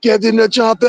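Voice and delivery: hammed-up Austrian action-star impression; Arnold. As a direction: call it out like a movie hero. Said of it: "looking like Arnold".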